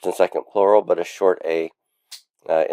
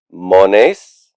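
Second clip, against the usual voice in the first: "Mones" drawn out, lifting at the end; recitation for repetition. Shown as a demonstration. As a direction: model the word for a class to echo. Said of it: "You can repeat after me".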